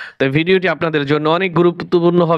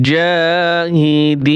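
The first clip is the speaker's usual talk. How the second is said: slow drawn-out reading; 2 semitones higher, much slower than usual. Shown as a demonstration. Teacher to students, showing how to blend the syllables, slow chanted reading. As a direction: stretch every syllable, very slow and sung out. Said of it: "বানান করে পড়বেন".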